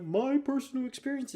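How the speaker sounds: high, mocking sing-song; mocking whiny quote. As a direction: mimic her sulky complaint. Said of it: "she's getting her feelings saying".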